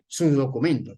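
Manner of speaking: a policeman's controlled, low-pitched demand; firm authority. Acted. Policeman to driver, not getting upset, firm. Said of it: "la mirada, el tono de voz".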